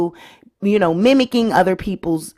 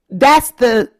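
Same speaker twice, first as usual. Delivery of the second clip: usual speaking voice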